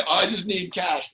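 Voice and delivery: slow, high whiny mock voice; mocking beggar. Driver mocking the beggar's demand, slow whiny voice. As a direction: whine it to mock him.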